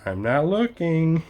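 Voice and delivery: slow, lightly mocking, absent-minded; oblivious pedestrian. Imitating a pedestrian stepping out, mild oblivious drawl. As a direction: drawl out the clueless excuse. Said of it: "and then".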